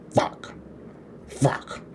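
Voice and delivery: soft, voiceless mock last gasps; imitated dying croak. Dying coroner after the wreck keeps cursing, imitated weak gasp. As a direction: imitate an injured man barely getting the word out.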